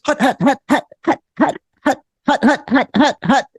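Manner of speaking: high, sharp staccato huts; little high-pitched grunts in bursts; imitated marching chant. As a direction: mimic tiny marchers chanting in rhythm. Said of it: "all of a sudden I hear this".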